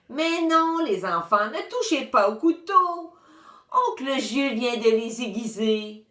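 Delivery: a hint of a flustered grandma; fussing old lady. A narrator's reading. Madame Rose scolding the twins.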